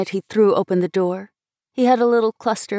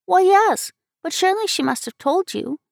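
put-on high-pitched, eager child's voice; little girl; 11 semitones higher than usual. A narrator's reading. Child replying to an older girl, girlish. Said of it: "said the little girl".

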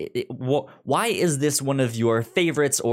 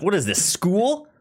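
mock indignant; 8 semitones higher, 3 dB louder than usual. Mock indignant teacher joke.

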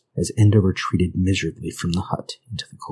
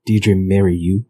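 louder, slow, drawn-out jeer; mocking cackle; 2 semitones higher, 8 dB louder, slower than usual. A narrator's reading. Father jeering at Enda's wish to marry, mocking. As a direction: scoff at a ridiculous idea. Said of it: "his father cackled".

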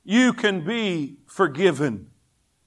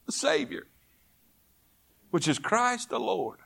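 much softer, reverent drop in volume; hushed reveal; 11 dB quieter than usual. Real, not acted. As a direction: let the announcement drop to a hush. Hushed payoff after loud build-up.

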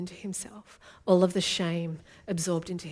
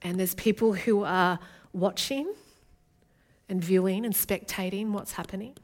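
ordinary talk

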